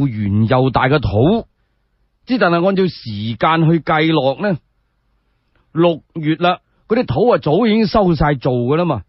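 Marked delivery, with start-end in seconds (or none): none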